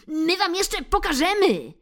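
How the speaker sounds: high, loud parting threat; shouting cats. Acted. Cats shout a threat while retreating, high and loud. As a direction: yell a threat over your shoulder. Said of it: "krzyczały".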